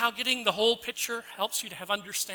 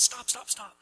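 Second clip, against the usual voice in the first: frustrated lawyer; 5 semitones higher than usual. Retold or imitated. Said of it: "he's really frustrated".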